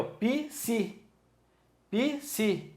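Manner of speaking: "Psi" split into pi, si, pitched high; high, spaced pi-si for "Psi"; two syllables. Shown as a demonstration. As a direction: sound out "Psi" as two separate beats, pi-si. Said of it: "eu pronuncio duas aqui".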